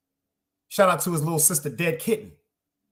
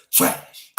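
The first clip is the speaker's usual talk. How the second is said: dismissive verdict. Real, not acted. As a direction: spit the word out, breathy and scornful. Verdict on each team.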